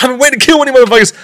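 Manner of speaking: high, fast laughing rerun of the threat; laughing retelling. Retold or imitated.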